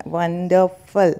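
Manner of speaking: वंडरफुल slowly, silent R and weak "fuhl"; correct pronunciation. Shown as a demonstration. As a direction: model वंडरफुल as WUN-duh-fuhl. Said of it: "किस तरीके से इसका उच्चारण". (वंडरफुल का सही उच्चारण)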